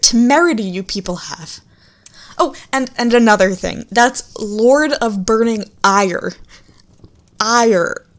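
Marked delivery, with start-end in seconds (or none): 3.9-6.3 "Ire" drawn out slowly and firmly; stress on Ire. Shown as a demonstration. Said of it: "Ire as in rage". 7.4-8.1 "Ire" said as one syllable like rage; pronounce Ire. Shown as a demonstration. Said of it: "Ire as in rage, not iron".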